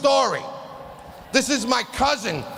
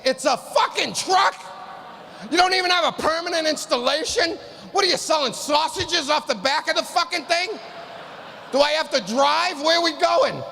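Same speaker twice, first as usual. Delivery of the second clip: incredulous rant; 5 semitones higher than usual. Retold or imitated. Comic ranting at the hospital receptionist about the truck. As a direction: rant at them in disbelief.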